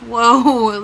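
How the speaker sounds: loud, retold "whoa" of surprise; big reenacted reaction of disbelief; taken aback. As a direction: blurt it out loudly, mock-startled. Taken aback by caller's anger.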